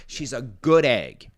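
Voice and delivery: normal talking voice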